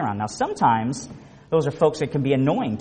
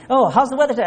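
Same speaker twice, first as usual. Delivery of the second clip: bright high mock greeting, a bit louder; cheery greeter; 9 semitones higher, 4 dB louder, faster than usual. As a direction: play the upbeat friend asking about the weather. Cheery greeting answered by complainer.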